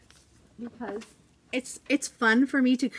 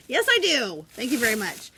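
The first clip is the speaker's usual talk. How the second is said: mock offended; 4 semitones higher, 6 dB louder than usual. Mock-offended reply to kids.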